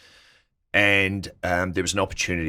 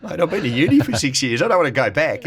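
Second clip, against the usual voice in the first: higher, louder mock-exasperated protest; exasperated retold protest; 11 semitones higher, 4 dB louder than usual. Retelling how he protested to Phil, mock exasperated. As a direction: throw up your hands, half joking.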